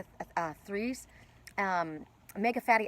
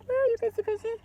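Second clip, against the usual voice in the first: much higher, sing-song calling. Real, not acted. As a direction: call the fish in a high sing-song. Calling the fish again, higher.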